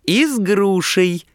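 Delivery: put-on high-pitched girlish call, stretched out; eager chiming in. A narrator's reading. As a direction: chime in with a high, drawn-out cry. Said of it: "также быстро подхватила Манька Беленькая".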